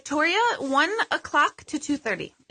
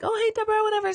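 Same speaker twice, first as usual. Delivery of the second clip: bright, much higher greeting voice; chirpy greeting; 12 semitones higher, much faster than usual. Retold or imitated. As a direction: greet her like an old friend, pitched way up. Acquaintance greeting her at shop.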